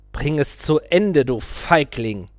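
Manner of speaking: slow, loud mocking taunt; sneering. A narrator's reading. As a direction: sneer it slowly at a coward. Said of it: "sagte der Guru höhnisch".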